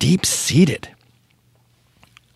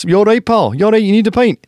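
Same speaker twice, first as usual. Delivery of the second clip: high, loud mock Glaswegian pub voice; Glaswegian pub regular; 12 semitones higher, 6 dB louder than usual. Pub regular offering pints, loud mock Glaswegian. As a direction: push a pint on him in a hammed-up Glaswegian accent. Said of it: "like a Glaswegian pub".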